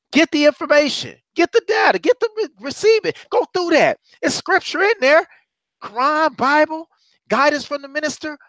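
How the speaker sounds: preaching. Acted. Preacher urging brothers and sisters, rising fervor. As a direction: rise up and exhort the congregation.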